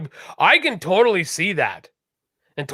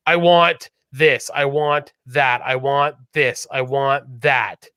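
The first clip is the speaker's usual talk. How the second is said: slow, heavily spaced list of demands; mimicked demanding customer; 4 semitones lower, much slower than usual. Imitating customers ordering with no manners, slow and demanding. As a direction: order item by item, each want drawn out.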